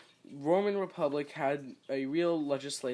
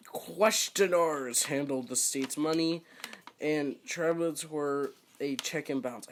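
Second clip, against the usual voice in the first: ordinary delivery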